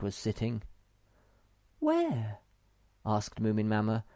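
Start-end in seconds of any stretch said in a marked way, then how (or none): 1.8-2.4 very high, puzzled mother voice; motherly questioning. Acted. Mother looks for the cake, high and puzzled. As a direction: ask it very high, searching. Moomin Mama hunts for cake.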